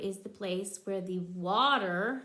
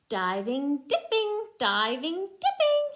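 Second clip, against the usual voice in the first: diving dipping with a louder, higher dipping; stress the D sounds; 4 semitones higher, 7 dB louder than usual. Shown as a demonstration. Showing her child alliteration, stressing the D in diving dipping. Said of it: "They both start with the letter D".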